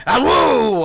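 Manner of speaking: loud high awoo howl; wolf howl demo. Shown as a demonstration. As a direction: howl it from the diaphragm. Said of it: "get a little more deeper in that diaphragm".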